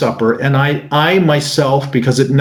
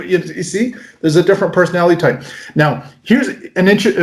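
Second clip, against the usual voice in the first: normal talking voice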